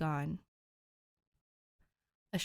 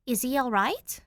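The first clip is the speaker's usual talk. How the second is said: demanding, panicked; 7 semitones higher, 6 dB louder than usual. A narrator's reading. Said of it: "Danny demands, a thread of panic".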